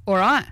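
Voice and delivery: "All right" run together as a quick question; UK greeting. Retold or imitated. Showing how parts of the UK greet, "All right" as rising greeting. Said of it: "In parts of the U K, they go".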